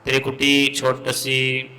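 ordinary delivery

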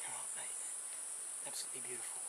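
hushed, breathy whisper of awe; awed whisper. Real, not acted. Handler admiring the king brown, in a hushed whisper. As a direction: breathe it out in wonder.

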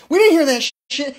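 sarcastic mock yell at the neighbours; mocking outburst. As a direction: blurt it out in mock disbelief.

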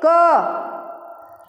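a crisp "क", throat closed, little breath; a tight, unaspirated "क"; short unaspirated ka. Shown as a demonstration. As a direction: clip "क" with almost no air. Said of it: "सबसे पहले बोलिए".

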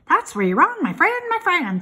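high, loud fussy goose voice; a goose scolding the pig, high and quick; chattering goose. A narrator's reading. Goose tells Wilbur he's wrong, high and loud.

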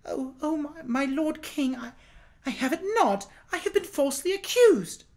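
accused woman pleading with the king in terror; frightened plea. A narrator's reading. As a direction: beg for your life, tripping on words.

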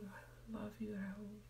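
soft, tender whisper to yourself; loving whisper. Shown as a demonstration. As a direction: whisper it like you mean it. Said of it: "If your name is Raoul".

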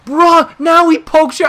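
indignant at the cheap poke; outraged yell. Real, not acted. Raging at opponent's poke.

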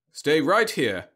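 mildly reassuring machine voice; calm the child, by rote; reassuring robot. Acted. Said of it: "Roby said reassuringly".

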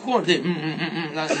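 slow, muffled scolding hums; mother's muffled grumble. Retold or imitated. Mother grumbles through closed lips while pulling hair. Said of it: "al son de".

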